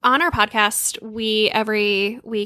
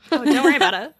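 laughing reassurance; 4 semitones higher, faster than usual. Real, not acted. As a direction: say it through a laugh, higher. Reassuring guest after squeal.

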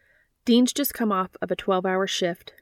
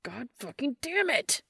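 near shout; 6 semitones higher, 3 dB quieter than usual. Acted. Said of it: "Dean nearly shouts".

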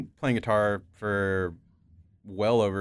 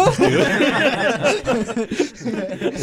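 falsetto; 10 dB louder, much slower than usual. Pretend. Falsetto after "do some falsetto.

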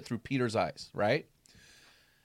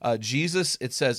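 ordinary talk, nothing marked

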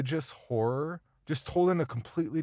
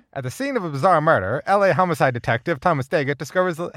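put-on loud, higher old-time newsreel announcer; 1930s voice; 6 semitones higher, 9 dB louder than usual. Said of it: "Do I have to do nineteen thirties voice?".

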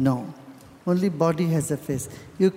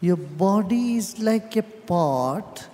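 guided meditation; 4 semitones lower than usual. Real, not acted. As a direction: guide them inward, calm and unhurried, with long pauses.

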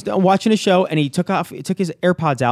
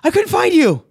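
put-on anxious teen; 13 semitones higher, 4 dB louder than usual. Son explains, panicked.

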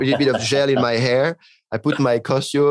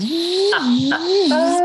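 high laugh. Real, not acted. Recalling the driver who drove like a pilot, laughing. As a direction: burst out laughing at the memory.

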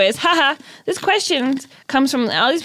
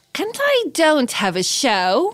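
slow, higher British mimicry; teasing British imitation, slow and high; mock British accent; 4 semitones higher, slower than usual. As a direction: draw it out in put-on British. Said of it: "I'm doing what you did".

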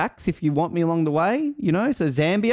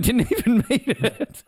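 laughing through it, higher and slow; 9 semitones higher, slower than usual. Real, not acted. Amused at New Zealand failing its reserve, laughing. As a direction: giggle out the punchline.

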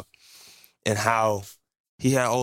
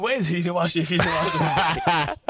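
laughing disbelief; 7 semitones higher than usual. Retold or imitated. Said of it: "I'm like".

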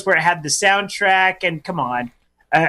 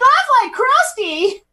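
crusty clown voice; slower than usual. Pretend. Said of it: "so you get crusty".

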